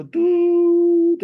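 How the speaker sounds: high, loud held toot; imitated ram's horn blast; shofar blast. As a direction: hoot one long note like a horn.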